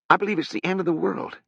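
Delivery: put-on high, fast, gloomy young voice. Harrogate tells Sutri his fear, high and quick.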